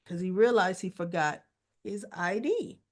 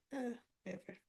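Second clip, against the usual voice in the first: normal talking voice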